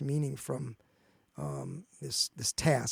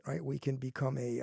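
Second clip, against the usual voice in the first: ordinary delivery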